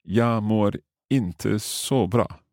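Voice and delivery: "Jag mår inte så bra" spelt out word by word, very slowly; slow careful. Shown as a demonstration. Host tries the new Swedish phrase on his teacher, slowly. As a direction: learner sounding out a new phrase. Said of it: "I'll try saying that quickly like you".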